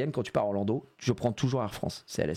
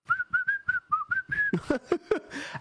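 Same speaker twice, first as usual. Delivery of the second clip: burst of laughter. Real, not acted. Streamer laughs at his wife's answer, high burst of laughter. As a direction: really crack up at it.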